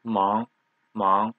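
Mang starting low and rising; teacher's rising-tone demo of Mang; second tone. Shown as a demonstration. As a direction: lift the pitch through Mang. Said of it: "Second tone".

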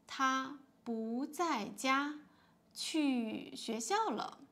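teacher reading a dialogue aloud slowly; slow model reading. Shown as a demonstration. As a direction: read the dialogue slowly and clearly. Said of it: "now let's read".

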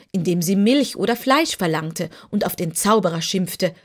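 plain reading voice

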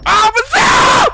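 excited shout. Real, not acted. Sudden shout mid-fight.

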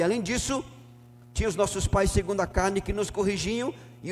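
loud, ringing scripture reading from the pulpit; full-force preaching. Acted. Pastor reading Hebrews to the church, loud fervent preaching. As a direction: proclaim every verse to the back row.

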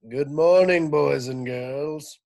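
loud principal on the PA; over the loudspeaker. Acted. Mister Kidswatter greeting school, loud loudspeaker announcement. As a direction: boom it out over the speakers. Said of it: "voice came over the loudspeaker".

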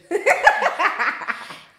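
high, loud laugh. Real, not acted. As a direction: burst out laughing.

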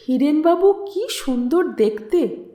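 plain reading voice

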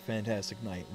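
ordinary talk, nothing marked

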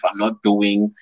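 ordinary delivery

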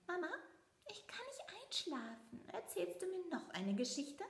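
put-on high, pleading little boy; child mouse voice. A narrator's reading. Leo creeps to his mother and asks for a story. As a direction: sleepless mouse begging for a story.

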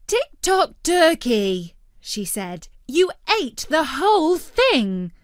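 disappointed telling-off; Future Dog's voice. A narrator's reading.

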